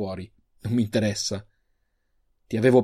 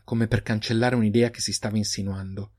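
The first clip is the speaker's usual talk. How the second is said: ordinary delivery, nothing marked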